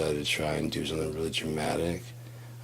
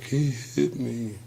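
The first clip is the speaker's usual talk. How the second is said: slow, higher mock-pitiful woman's voice; mock accuser; 6 semitones higher, slower than usual. Girlfriend playing the victim to police, mock pitiful and slow. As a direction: whimper it like a fake victim. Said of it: "'cause she's like".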